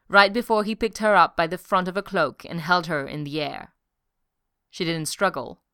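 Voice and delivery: ordinary delivery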